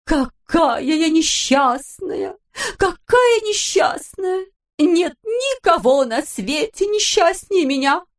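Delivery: slow, high melodramatic lament; wailing countess. A narrator's reading. Countess on stage wailing about her fate, melodramatic. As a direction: ham up the tragic countess. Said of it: "стала сетовать".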